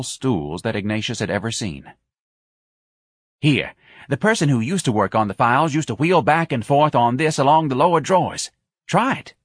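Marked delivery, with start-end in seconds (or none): 3.4-9.3 higher-pitched, eager little boss; eager office manager. Acted. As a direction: offer it helpfully in a high tenor. Voices Gonzalez in the story.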